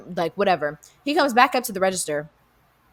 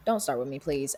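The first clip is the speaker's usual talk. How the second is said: softer, lower, tired plea; weary warning; 6 semitones lower, 9 dB quieter than usual. Retold or imitated. Telling the regular not to start, quiet weary warning. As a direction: say it low and drained.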